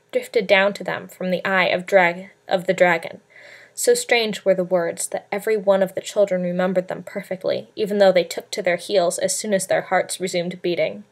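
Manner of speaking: ordinary delivery, nothing marked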